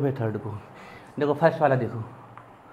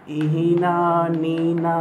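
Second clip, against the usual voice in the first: drawn out slowly; much slower than usual. Shown as a demonstration. Teacher to students, drawing out the mnemonic name slowly. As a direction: stretch Hina very slowly, syllable by syllable. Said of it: "कई बार question पूछा गया है".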